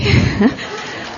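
short voiceless chuckle; a delighted little laugh; soft breathy laugh. Real, not acted. Laughing about daughter.

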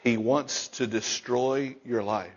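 low, soft, ominous; preacher hushing the congregation before a warning; hushed warning. Acted. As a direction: warn them quietly, leaning in.

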